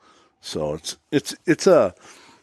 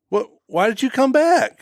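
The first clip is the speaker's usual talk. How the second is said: surprised wife; 14 semitones higher than usual. Retold or imitated. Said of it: "my wife says".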